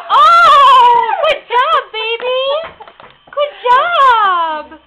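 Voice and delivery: put-on high, loud praising the baby; excited praise. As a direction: cheer the baby on. Mother praising baby eating.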